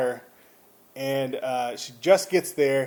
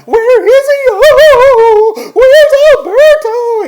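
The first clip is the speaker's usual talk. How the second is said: very high, loud wail, stretched at the end; freaking out; 19 dB louder, much slower than usual. Retold or imitated. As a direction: imitate a panicked wife screaming. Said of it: "freaking out".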